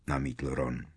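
plain reading voice

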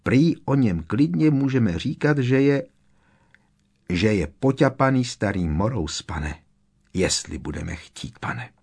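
usual speaking voice

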